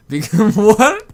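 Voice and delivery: loud incredulous laugh; what, laughing in disbelief. Real, not acted. Incredulous at quiz answer.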